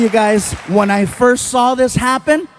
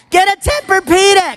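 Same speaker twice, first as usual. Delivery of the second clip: high, excited ad-voice shout; sudden pitched-up infomercial cry; comic sales pitch; 9 semitones higher than usual. Acted. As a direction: pitch it like a mattress commercial. Comic ad-style exclamation.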